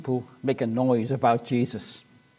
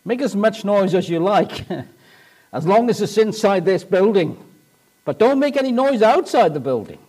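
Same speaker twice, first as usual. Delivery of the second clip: devil's voice; 5 semitones higher, 4 dB louder than usual. Acted. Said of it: "the devil would say to us".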